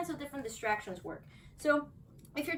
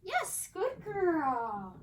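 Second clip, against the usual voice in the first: slow, drawn-out high praise; 6 semitones higher, 4 dB louder, much slower than usual. Real, not acted. Trainer slowly praising her dog.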